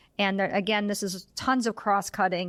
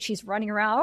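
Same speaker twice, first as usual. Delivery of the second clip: ordinary talk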